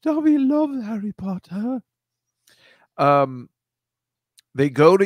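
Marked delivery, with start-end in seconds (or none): 0.0-1.8 high squeaky house-elf voice; slow, high elf whine in third person; Dobby. Acted. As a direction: play a pitiful high-pitched little elf. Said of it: "this guy's dressed like Dobby".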